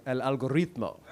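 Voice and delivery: rolled Spanish accent on el algoritmo; Spanish pronunciation. Shown as a demonstration. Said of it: "Excuse me".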